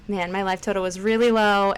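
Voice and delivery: usual speaking voice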